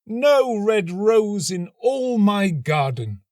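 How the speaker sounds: put-on high, slow, pleading young student. A narrator's reading. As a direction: ache for the roses, lifted high and slow. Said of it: "he cried".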